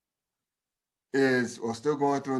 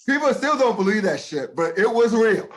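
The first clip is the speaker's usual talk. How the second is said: higher, louder, insistent; raised voice insisting it was real; emphatic insistence; 8 semitones higher, 5 dB louder than usual. Real, not acted. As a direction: drive the point home hard.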